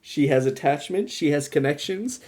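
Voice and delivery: usual speaking voice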